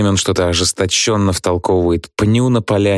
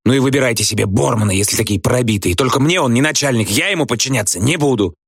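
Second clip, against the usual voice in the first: higher-pitched resentful outburst; spiteful; 5 semitones higher than usual. A narrator's reading. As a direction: refuse to submit, with spite. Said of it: "злобно плюёт в костёр". (злобно, с обидой)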